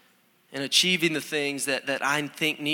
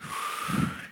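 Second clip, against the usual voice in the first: a long breathy sigh, worn out; slow exhausted exhale; weary sigh; 6 dB quieter than usual. Retold or imitated. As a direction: let out a tired sigh into the steam. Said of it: "and I'd just be like".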